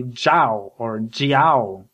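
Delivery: Jiao stretched slowly into "jee-OW"; British mispronunciation. Retold or imitated. British people attempting his name, slow mangled Jiao. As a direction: draw Jiao out like a Chinese name. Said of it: "British people pronounce my name differently".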